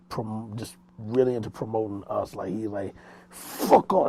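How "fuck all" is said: raised, high-pitched forceful impression; Headquarters, forceful. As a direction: imitate a friend's explosive way of talking. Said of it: "everything is just forceful".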